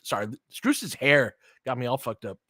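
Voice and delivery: normal talking voice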